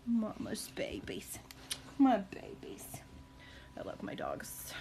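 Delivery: whispered endearments; tender whisper. Real, not acted. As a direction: whisper love to the dogs. Whispering fondly to her dogs.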